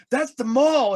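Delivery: excited realization. Retold or imitated. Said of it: "he goes".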